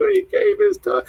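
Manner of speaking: very high, squeaky tearful whine; mock crying. Retelling her movie theater crying, high mock sob. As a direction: imitate yourself blubbering in a tiny high voice. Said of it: "what I kept saying when I was crying".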